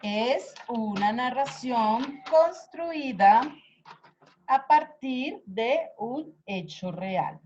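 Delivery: slow, deliberate dictation pace; dictating word by word, slowly. Shown as a demonstration. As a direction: spell it out slowly for note-taking.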